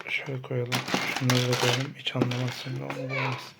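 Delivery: low, very soft self-talk; secretive murmur. Real, not acted. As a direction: murmur to yourself while hiding things. Secretly hiding items from child.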